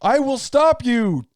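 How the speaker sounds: high, slow, stilted line read; rushed bad actor line. Host mocking Orgasmo's line, high and stilted. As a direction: deliver it like a porn actor who hates the line. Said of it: "he's like".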